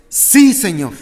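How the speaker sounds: ringing "Sí" answered out loud; loud affirmation. Acted. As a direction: answer with full conviction. Blind men answering Jesus, voiced.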